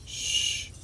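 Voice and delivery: soft whispered hush; hushed, voiceless shh; secretive shush. Pretend. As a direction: shush them like it's a secret. Hushing as if keeping a secret.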